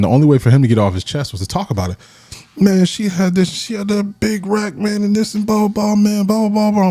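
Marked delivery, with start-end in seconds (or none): 2.6-6.9 much higher mock voice of a smitten friend; high, put-on buddy voice gushing; imitating his friend. Friend gushing to the speaker in a high mock voice. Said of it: "He's like, man".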